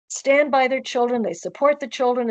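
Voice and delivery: ordinary talk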